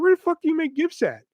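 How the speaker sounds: really baffled, pitch up; baffled amazement. Retold or imitated. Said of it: "So I was like".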